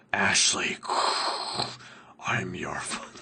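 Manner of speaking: a bad whispered Darth Vader, breaking off; a weak stab at the Vader whisper; terrible Darth Vader. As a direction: whisper it like a failed villain impression. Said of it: "That was a terrible impersonation".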